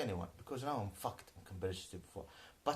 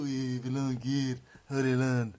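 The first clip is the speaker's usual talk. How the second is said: mock opponent voice; 8 dB louder, slower than usual. Mocking the other side's claim.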